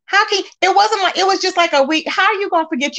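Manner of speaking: high-pitched disbelief; incredulous. Real, not acted. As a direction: voice jumps up in outraged disbelief. Ranting about a lie on Bigo.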